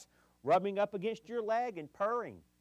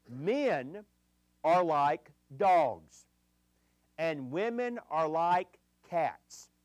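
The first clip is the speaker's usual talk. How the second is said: drawn out, each word set apart; slow, deliberate delivery of the joke; slow punchline; 4 dB louder, much slower than usual. Acted. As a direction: land the punchline slowly and deliberately. Punchline of the analogy.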